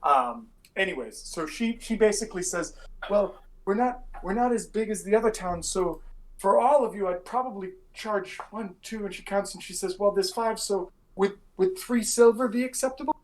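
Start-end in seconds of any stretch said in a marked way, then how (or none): 3.1-13.1 lightly voiced innkeeper, a little higher. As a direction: play a polite innkeeper, a bit higher pitched.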